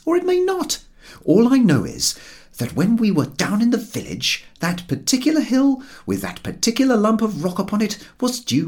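a lightly voiced sardonic companion; George's dry voice. A narrator's reading. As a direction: lay the correction on dryly. George argues in story.

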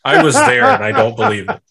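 high, fast incredulous outburst; mock disbelief. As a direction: blurt it in shocked disbelief. Incredulous mother voice.

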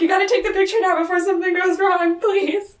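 high, fast, loud mock begging; urgent plea. Host begs for the picture to be taken, urgent. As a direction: rush it out as a comic plea.

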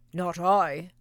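loud gruff bark of a dog; lazy dog barking. Acted. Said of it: "barked the lazy dog".

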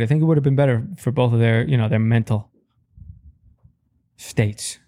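ordinary talk, nothing marked

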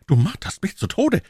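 high, impatient exclamation; acted exasperated outcry; agitated cry. A narrator's reading. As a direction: plead as if tormented by suspense. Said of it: "rief Aramis".

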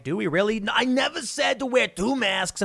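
high, incredulous denial voice; mock Fauci backpedaling. Imitating Fauci denying he said it, high mocking voice. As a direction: play dumb and deny it in a put-on voice. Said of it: "then they're like".

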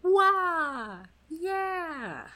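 high excited whoop; cheering. Real, not acted.

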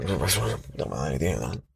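very low, quiet grumble; slow, hushed mutter of irritation; annoyed muttering. Shown as a demonstration. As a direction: grumble crossly under your breath. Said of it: "como un poco con tono de cabreado".

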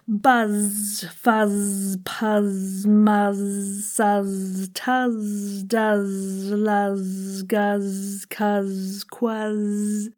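each word like "Buzz" held on a long zzz; steady monotone with lingering buzzed endings on "quuzz". Shown as a demonstration. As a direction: draw out the final Z on "Buzz, fuzz, puzz". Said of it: "other zzz words".

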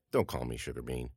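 low, soft, curt objection; embarrassed rebuff. Acted. Child to Daddy in front of principal, embarrassed rebuff. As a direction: shut the nickname down under your breath.

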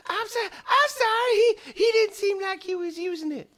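high squeaky cat voice, pleading; apologetic cat. Acted. Cat excusing itself to the toe's owner, apologetic squeak.

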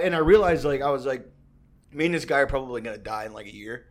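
ordinary talk, nothing marked

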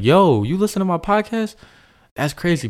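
imitating his own surprised reaction; surprised excitement. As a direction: act amazed someone knows the show. Retelling surprise at meeting a listener.

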